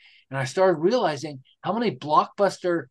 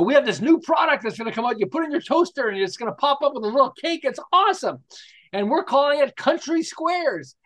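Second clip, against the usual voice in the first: fast, higher, excited product pitch; hyped announcement; 7 semitones higher than usual. Retold or imitated. A cereal boss proudly announcing his toaster cake, excited. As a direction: sell it like a proud launch. Competitor hyping new product.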